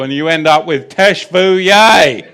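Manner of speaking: loud mock Arkansas mispronunciation of "teshuvah"; Arkansas accent. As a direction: belt out "teshuvah" with an exaggerated Arkansas twang. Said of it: "That's how they do it in Arkansas".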